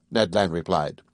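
ordinary delivery

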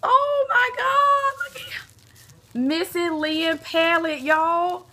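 high, slow, stretched-out squeal of delight; thrilled squeal. Real, not acted. Vlogger unboxing the palette she always wanted, squealing with delight. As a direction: gasp and squeal over a dream gift.

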